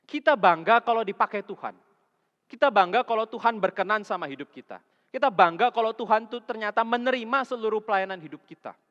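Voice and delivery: loud, high, driving sermon voice; full-force preaching. Acted. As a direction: preach it hard to the congregation. Preaching climax on pride. (khotbah berapi-api dengan suara keras)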